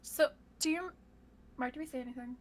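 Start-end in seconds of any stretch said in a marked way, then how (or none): none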